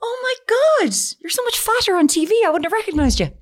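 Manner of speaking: high gushing stranger; high-pitched mock fan gushing at a funeral. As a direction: squeal it like a starstruck fan. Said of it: "at a funeral and going".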